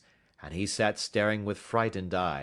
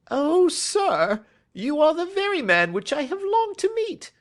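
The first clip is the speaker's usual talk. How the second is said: high, loud, eager cry of a timid little man; high excited plea from a jittery little fellow; nervous little man crying out; 8 dB louder than usual. A narrator's reading. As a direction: reach out to him, voice up high and pleading. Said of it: "cried the little fellow".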